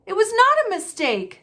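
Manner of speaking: put-on high, loud angry boy. A narrator's reading. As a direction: protest angrily. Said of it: "said Harry angrily".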